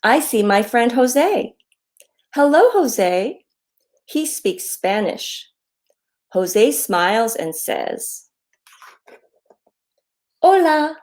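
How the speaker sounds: slow down and sing out each greeting; reading to children. A narrator's reading. Author reads her hello book to children, friendly read-aloud.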